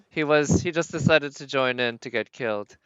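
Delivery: loud, amused reply to chat; excited. Real, not acted. Streamer answering a viewer, loud and amused.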